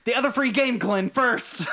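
high, loud prodding call. Real, not acted. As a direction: call him out loudly, teasing. Pushing friend to answer.

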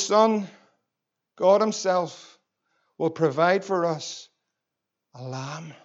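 low, soft and slow, fading to almost nothing; tender fatherly reply. Acted. Abraham answers his son about the lamb, tender and hushed.

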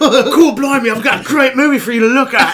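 high, loud hammed-up British pitch; mock English accent. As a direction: play a smarmy English director selling a film. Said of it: "that smooth English accent".

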